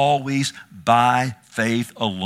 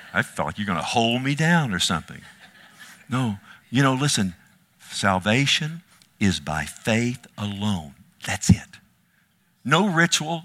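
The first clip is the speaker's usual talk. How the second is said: ordinary talk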